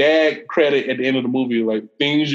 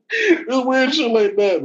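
laughing; 11 semitones higher, much slower than usual. Real, not acted. Laughing while speaking.

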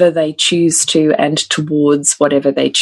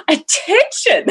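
put-on pompous little-girl call, pitched higher; high toddler attention; slower than usual. Mother imitating daughter demanding attention, high call. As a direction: announce it like a tiny boss. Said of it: "she's like".

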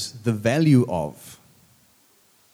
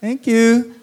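high, bright mock gratitude; a put-on polite thank-you, much higher; fake polite thanks; 8 semitones higher, 3 dB louder than usual. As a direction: thank them sweetly while clueless. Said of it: "I go".

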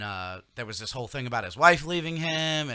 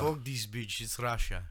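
put-on Russian accent; 4 dB quieter than usual. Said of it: "Bert Kreischer's story".

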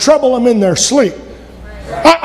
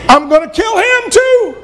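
Herod's threat; 10 semitones higher than usual. Retold or imitated. Herod voiced in story.

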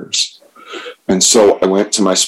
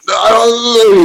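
a much higher, louder, squeaky "I don't know"; sheepish squeak; 5 dB louder, slower than usual. Retold or imitated. Answering the sponsor about harming capitalism, squeaky and sheepish. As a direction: play his younger self, small and helpless.

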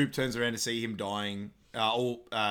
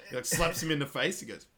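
usual speaking voice

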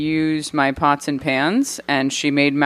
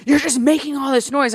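annoyed roommate; 9 semitones higher than usual. Retold or imitated. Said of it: "she's like".

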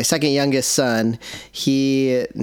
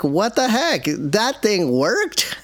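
amazed disbelief; 9 semitones higher, much slower than usual. Retold or imitated. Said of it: "I was like".

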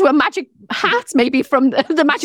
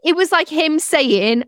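ordinary talk

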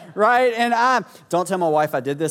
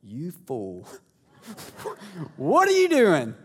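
voiced stuffed lamb; slower than usual. Little lamb looking up at dad, mock scolding. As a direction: scold as a talking toy, starting hushed, then jumping up. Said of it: "it says".

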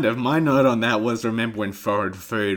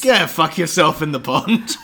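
loud Scottish ogre, higher; Shrek voice; 6 semitones higher, 6 dB louder than usual. Acted. Cohost joining the Shrek bit in a loud Scottish voice. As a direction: shout it like a rude Shrek.

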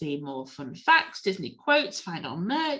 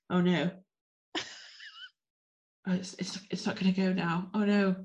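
holding back a sneeze; about to sneeze; 5 dB quieter than usual. Real, not acted. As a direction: sneeze coming, voice catching. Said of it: "I'm gonna sneeze again".

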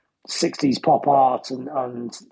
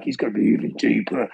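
lightly dropped, lower voice; mock deep voice; 5 semitones lower than usual. Guessing how the bandmate's voice will sound, slightly mock deep. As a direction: sink the pitch in expectation of a deeper voice. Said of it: "what's his voice gonna be like?".

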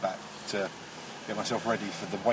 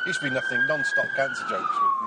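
very high, mocking falsetto; high cartoon voice for the tease; squeaky high voice; 4 dB louder than usual.

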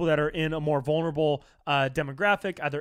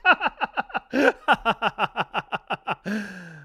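mock loud laugh; 5 semitones higher, 3 dB louder than usual. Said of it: "they're just like".

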